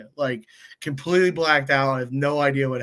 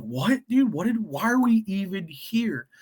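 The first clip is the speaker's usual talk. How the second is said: baffled; 6 semitones higher than usual. Retold or imitated. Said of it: "we're like".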